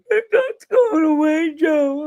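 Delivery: high-pitched imitation of tearful coworkers; mock tearful. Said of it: "almost in tears. They're like".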